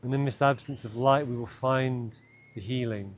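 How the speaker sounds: calm, unhurried meditation voice with long pauses; guided meditation. Acted. Guide leading listeners into an imagined cave, guided meditation. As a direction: lead them gently inward, leaving space between phrases.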